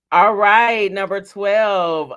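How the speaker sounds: loud drawn-out number call; bingo caller. Acted.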